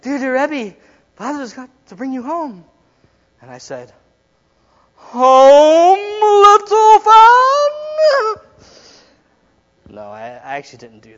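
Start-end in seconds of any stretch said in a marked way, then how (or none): 0.0-2.6 higher theatrical line reading; sister's stage line. Retold or imitated. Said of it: "she was like". 5.0-8.4 very high, loud, drawn-out wail; hammed-up line. Climax, wildly overdone line.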